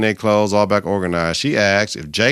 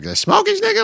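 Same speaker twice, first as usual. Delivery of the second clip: mock squeaky surprise. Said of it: "He's like".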